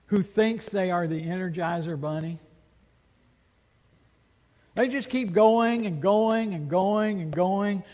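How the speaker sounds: ordinary delivery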